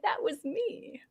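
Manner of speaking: very soft, whispered quote; hushed mock admission. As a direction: imitate a sheepish confession in a near-whisper. Imitating the other's shy admission.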